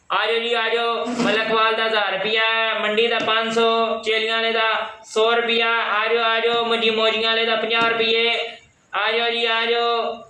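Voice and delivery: high hawking call for passengers; rickshaw driver calling. Pretend.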